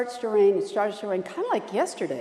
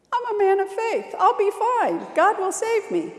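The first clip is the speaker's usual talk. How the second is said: high, louder insistent refusal; stubborn faithful man; 11 semitones higher, 5 dB louder than usual. Acted. Man on his roof refusing the helicopter, high-pitched and stubborn. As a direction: dig in, sure God will come. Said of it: "The guy says".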